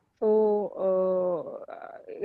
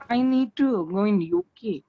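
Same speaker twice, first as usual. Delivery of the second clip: usual speaking voice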